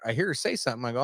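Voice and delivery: normal talking voice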